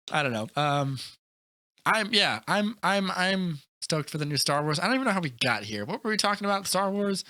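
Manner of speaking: normal talking voice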